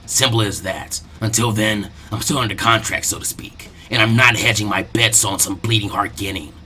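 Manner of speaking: a hint of a cold mercenary; offhand villain. Zabuza brushing off Asuma's warning, offhand.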